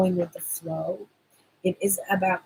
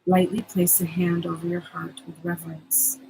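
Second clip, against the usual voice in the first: guided meditation. Acted. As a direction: soothe them into calm, unhurried. Leads the promised meditation.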